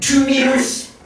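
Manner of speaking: high, slow American car voice; imitation of an American parking-sensor voice; American accent. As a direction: count it out like an American dashboard computer, drawn out and high. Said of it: "in American accent".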